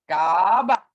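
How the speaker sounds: Gaba with a long drawn ah; Gaba, first vowel held long; dragged out. Shown as a demonstration. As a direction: drag out the ah in Gaba. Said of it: "That's dragged out".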